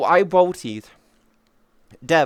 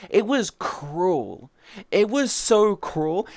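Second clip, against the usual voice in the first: gleeful drawn-out gloat; 5 semitones higher, much slower than usual. Real, not acted. Gleeful gloat about griefing.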